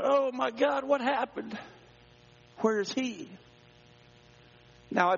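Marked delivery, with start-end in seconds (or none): 0.0-3.3 high, breaking cry of fear; father spotting the empty jet ski, alarmed; panicked alarm. Retold or imitated. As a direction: gasp it out as panic hits. Sees jet ski floating alone.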